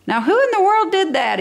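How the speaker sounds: high, startled exclamation; exclaiming watchman. Acted. Night watchman hearing a crash, startled high call. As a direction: call out into the dark store. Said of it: "he exclaimed".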